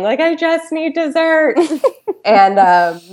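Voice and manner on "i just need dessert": higher, slow, hammed-up whimper; mock crying whine. Retelling crying on the kitchen floor, mock tearful slow whine. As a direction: play up a tearful craving. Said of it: "sitting in my kitchen on the floor crying".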